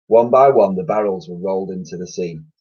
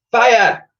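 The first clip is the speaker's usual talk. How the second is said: shouted command; 14 semitones higher, 7 dB louder than usual. Acted. Admiral commands fire.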